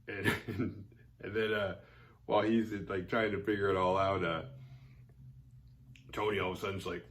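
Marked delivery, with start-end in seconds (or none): none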